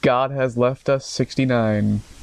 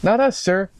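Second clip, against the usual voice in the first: higher officer reply; 7 semitones higher than usual. Acted. Officer answers commissioner, first take.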